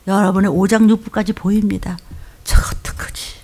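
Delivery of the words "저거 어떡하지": breathy mock whisper of a fretting pastor; whispered worry. Pastor imagining family listening, whispered worry. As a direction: hush it like a secret fear. Pastor's fearful inner thought quoted.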